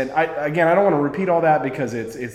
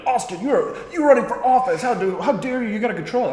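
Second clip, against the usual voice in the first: higher mock-scolding critic voice; 9 semitones higher than usual. Mocking critics who scold her candidacy, higher mock scold. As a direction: mimic the prissy critics scolding. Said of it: "They're like".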